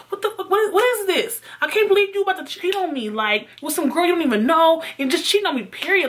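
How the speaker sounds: high, incredulous retold rant; acted-out indignant tirade; outraged accusation. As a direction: lay into a cheater, pitch way up. Acted quarrel, confronting the cheater.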